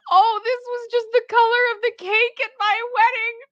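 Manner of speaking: high, hammed-up sobbing through laughter; mock sobbing. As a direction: overact a sob about a cake colour. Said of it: "had picked up an ivory dress and said".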